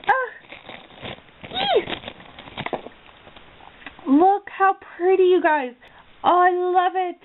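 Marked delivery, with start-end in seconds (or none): 1.5-2.0 a high squeal of delight at the gift; excited squeal. Real, not acted. Opening a gift from a friend, high squeal.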